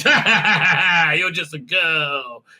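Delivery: slow, hammed-up sneering laugh; drawn-out fake gloating laughter; mock villain laugh. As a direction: imitate a villain laughing down at her. Villain mocks her in story.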